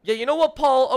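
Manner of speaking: high, loud huff of rejection; mock indignant outburst. Player turned down by Paul, mock indignant outburst. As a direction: play the jilted suitor flaring up.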